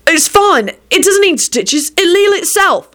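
high, defensive protest; flustered insistence. A narrator's reading. William hiding his healed leg, high flustered insistence.

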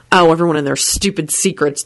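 plain reading voice

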